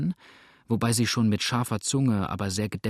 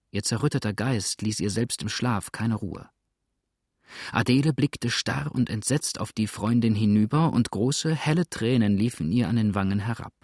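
ordinary delivery

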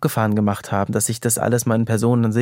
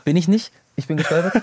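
surprised disbelief; 6 semitones higher than usual. Real, not acted. Disbelief at being told he stumbled.

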